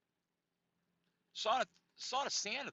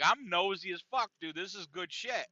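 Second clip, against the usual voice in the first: usual speaking voice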